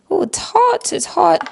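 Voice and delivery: sudden high yelp at the heat; startled by heat. Real, not acted. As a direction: react sharply to burning fingers. Grabbing hot sweet potato.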